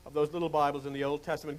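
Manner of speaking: ordinary talk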